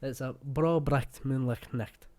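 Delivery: slow Scottish 'bro bricht moonlicht nicht' with throaty ch; broad Scottish 'moonlicht nicht', every ch rasped; Scottish tongue twister. Shown as a demonstration. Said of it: "that's like a Scottish tongue twister".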